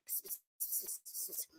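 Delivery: hushed psst noises; gossipy whispering. Retold or imitated. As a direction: imitate neighbours whispering secrets. Acting gossiping neighbours.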